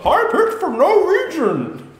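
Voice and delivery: high, slow squeaky Bane voice; high-pitched Bane. Retold or imitated. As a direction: squeak it out slowly. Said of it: "He's still like".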